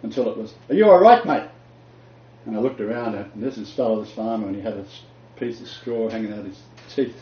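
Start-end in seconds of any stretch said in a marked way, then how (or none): 0.7-1.5 high, loud call right nearby; the voice arriving close; closer call. Retold or imitated. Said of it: "it got closer and closer".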